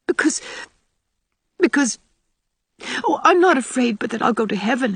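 high, halting confession with pauses; frightened. A narrator's reading. Ruby confessing her fear of death to Anne, frightened.

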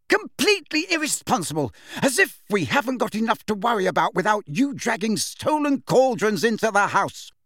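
high-pitched, outraged scolding; furious shout. A narrator's reading. Mother scolding over cauldrons brought home, furious shouting. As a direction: tear into them at the top of your voice. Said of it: "shouting at the top of her voice".

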